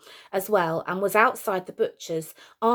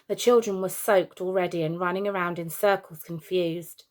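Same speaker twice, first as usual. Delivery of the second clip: ordinary delivery, nothing marked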